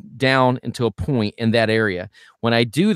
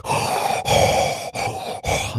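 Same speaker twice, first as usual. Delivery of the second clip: harsh gasp; 3 dB quieter than usual. Shown as a demonstration. Coach demonstrating the wrong breath: raspy gasp. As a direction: gasp in like Darth Vader. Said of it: "It's not like Darth Vader".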